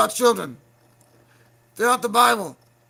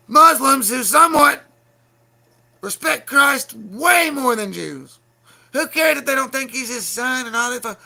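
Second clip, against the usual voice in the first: loud, higher angry yelling; heated shouting; 4 semitones higher, 6 dB louder than usual. Real, not acted. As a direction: hammer it home in anger. Heated rant defending Muslims.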